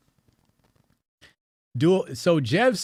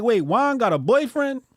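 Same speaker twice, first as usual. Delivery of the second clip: surprised; 12 semitones higher, 6 dB louder than usual. Retold or imitated. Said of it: "So I'm like".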